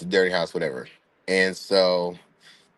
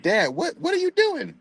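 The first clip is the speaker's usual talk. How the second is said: confused question. Retold or imitated. Confused daughter to dad.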